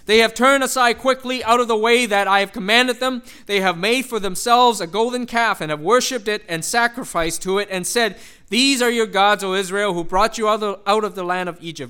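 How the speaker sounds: loud, declaiming Bible reading; forceful scripture reading. Acted. Preacher reads Exodus aloud, loud and declaiming. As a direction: proclaim the verse to the congregation.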